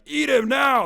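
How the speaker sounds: high, loud mock-savage order; hammed-up shouted command. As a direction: yell it like setting an attack dog on someone. Imagined hosts order the attack.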